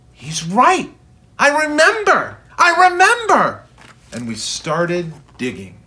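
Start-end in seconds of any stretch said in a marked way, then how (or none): 0.1-3.6 rising, louder and higher with each line; excited remembering. Acted. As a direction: recall it with growing joy. Father recalls buried blaster.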